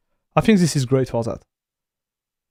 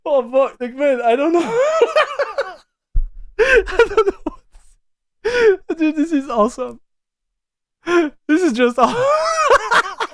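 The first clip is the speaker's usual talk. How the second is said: high, strangled words between sobs of laughter; tearful laughing, can't finish the word; crying with laughter; 14 semitones higher, slower than usual. Real, not acted. Streamer marvelling at the tool, crying with laughter. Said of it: "Man, I'm crying".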